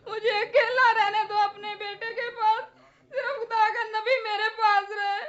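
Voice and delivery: tearful, grief-stricken wailing; crying. Acted. Mother tells the prophet her son has died, crying. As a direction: sob through the words in mourning. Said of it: "मत रो बेटी".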